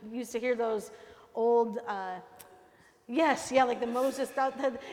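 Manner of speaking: normal talking voice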